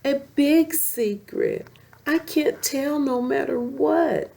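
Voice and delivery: high, insistent cub voice; cub crying out. A narrator's reading. Simba insisting to his mother in a high cub voice. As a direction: plead it high like a stubborn little cub. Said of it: "he cried".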